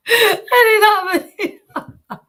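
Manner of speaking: high, loud, slow tearful laugh-cry on the chant; overwhelmed ecstatic sob-laugh. Real, not acted. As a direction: break into laughing tears. Overcome, crying-laughing chant.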